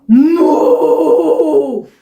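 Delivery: loud drawn-out moo; cow moo. Acted. Dog moos in story.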